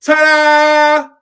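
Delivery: high, very loud showman ta-da; ringmaster ta-da. Retold or imitated. Preacher acting out the acrobats for the congregation, big showy ta-da. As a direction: belt it out like a circus performer. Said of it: "throw their arms up and go".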